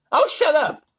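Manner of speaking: loud, high, testy outburst; exasperated lisping President; irritated lisp. A narrator's reading. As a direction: silence the flatterer sharply. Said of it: "I'm reading with a lisp now".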